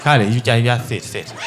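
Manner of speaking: low, curt, soft order to sit; a rider barely hiding his annoyance; annoyed rider. Retold or imitated. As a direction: rider curtly telling the cheap passenger to sit, low and soft. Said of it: "This is how you know he's annoyed".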